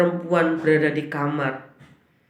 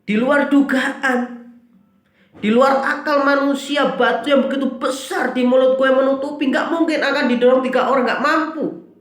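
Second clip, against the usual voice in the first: preaching at full force; 10 semitones higher, 4 dB louder than usual. Acted. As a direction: preach it with rising fervour. Preacher builds to a peak. (khotbah berapi-api, tinggi dan lantang)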